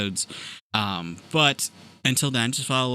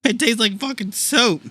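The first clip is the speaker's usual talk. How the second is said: disgusted, mouth full; high, disgusted, chewing; eating sour candy; 10 semitones higher than usual. Real, not acted. Disgusting jelly bean tasting.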